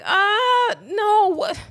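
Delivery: high, loud startled objection; girl's protest. Retold or imitated. Girl demanding to see her father's gift first, high and loud. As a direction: object loudly, pitch jumping up.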